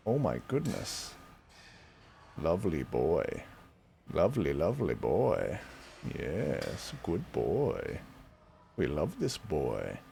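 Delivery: low, soft, adoring murmur; tender murmur. Real, not acted. Owner softly adoring his cat, low tender murmur. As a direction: murmur fondly to the pet.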